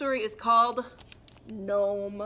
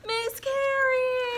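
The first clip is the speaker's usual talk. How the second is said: excited reveal; 14 semitones higher, 6 dB louder, much slower than usual. Acted. Announcing the special friend.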